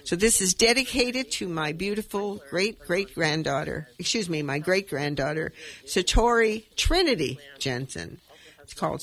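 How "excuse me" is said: ordinary talk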